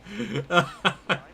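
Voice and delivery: bursting into laughter; cracking up. Real, not acted. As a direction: laugh at your own joke. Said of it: "I cracked myself up".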